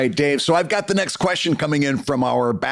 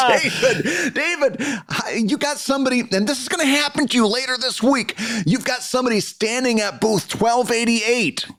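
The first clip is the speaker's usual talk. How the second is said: ordinary talk, nothing marked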